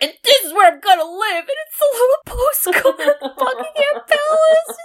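high, fast, whiny-dreamy voice with a crack; dreamy Yul. Retold or imitated. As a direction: gush about the dream palace like a kid. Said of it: "he's like".

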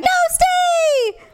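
pleading host. Retold or imitated. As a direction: coax her to stay. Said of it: "But you're like".

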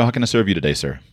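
ordinary talk

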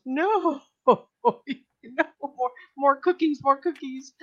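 high-pitched mock plea from the husband; protesting husband. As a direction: imitate a husband wailing over cookies. Husband protesting cookies brought home.

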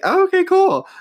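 high airy imitation of shrugging fans; casual fan voice. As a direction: chirp it way up, breezy and unbothered. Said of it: "they were like".

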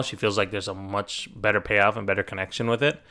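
ordinary delivery

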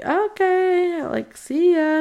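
high, sing-song goodbye; awkward farewell. Retold or imitated. Bishop leaving the caught couple, awkward high farewell. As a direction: imitate a too-polite, high-pitched send-off.

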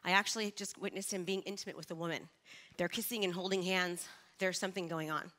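low, soft, solemn telling; hushed grave news. Retold or imitated. Friend on the phone telling Krista of her husband, soft and grave. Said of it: "And she goes".

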